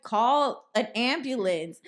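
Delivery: high, loud, stretched-out instruction; retold exasperated reply. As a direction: spell out the obvious loudly and slowly. Man answering fake injury plea, retold.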